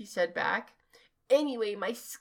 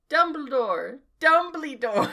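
raised, higher calling yell; 5 semitones higher, 5 dB louder than usual. A narrator's reading. Two scared students looking for Dumbledore, yelling. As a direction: call out for him, pitched up. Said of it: "We both yelled".

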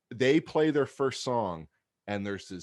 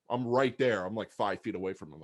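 ordinary delivery

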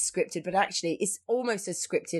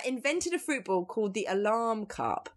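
ordinary talk

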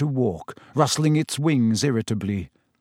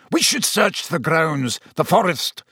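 higher, angry insistence; snarling; 4 semitones higher than usual. A narrator's reading. Executioner wants the thief hunted down, snarling. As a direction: snarl out the demand deliberately. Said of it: "the executioner was snarling".

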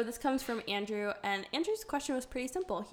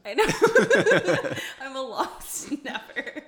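laughing; 3 semitones higher, 11 dB louder than usual. Real, not acted. Laughing through praise.

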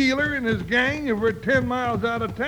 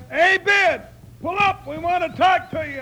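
hailing a rider, louder and higher; calling out; 6 semitones higher, 5 dB louder than usual. Acted. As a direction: call across to the galloping boy.

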